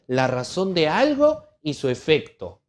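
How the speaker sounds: ordinary delivery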